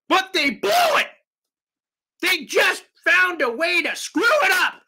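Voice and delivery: a fan yelling that they blew it; frustrated shout. Real, not acted. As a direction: bellow it at the team. Fan venting over a blown game.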